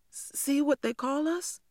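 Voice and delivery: high, slow, worried plea; anxious child. A narrator's reading. Girl anxiously points out the slur to the teacher. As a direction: afraid she missed it. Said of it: "afraid she had not seen".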